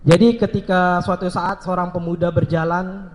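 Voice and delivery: ordinary talk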